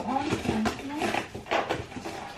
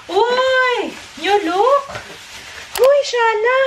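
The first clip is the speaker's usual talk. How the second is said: excited; 8 semitones higher, much slower than usual. Real, not acted. Delighted at new packaging.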